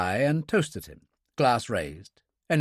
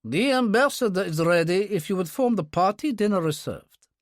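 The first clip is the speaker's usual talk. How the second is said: higher-pitched formal announcement; formal aide; 8 semitones higher than usual. A narrator's reading. An aide calls the guests to dinner, formally. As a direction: announce it with official courtesy. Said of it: "an aide approached and said formally".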